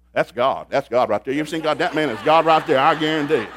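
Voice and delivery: loud, amazed cry that Paul is God; excited exclaim. Retold or imitated. Onlooker shouts to the others that Paul is God, loudly amazed. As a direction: proclaim it to the crowd in wonder.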